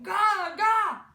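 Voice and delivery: very loud, high infant scream; full-volume high wail; screaming baby babble. A narrator's reading. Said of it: "continued to cry and scream".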